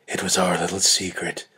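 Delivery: ordinary delivery, nothing marked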